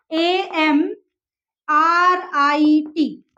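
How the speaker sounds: आर आई टी spelled very slowly, letter by letter; slow spelling. Shown as a demonstration. Teacher spelling Amrit for students, very slowly letter by letter. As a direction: stretch out every letter. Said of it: "मैं आपको बताती हूँ".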